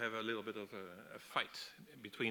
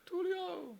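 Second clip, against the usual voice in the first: very high, loud drawn-out cry of a name; acted high-pitched woman's shout; alarmed calling out; 5 dB louder than usual. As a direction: call after someone drifting away, pitched way up. Linda calls out after Tulio.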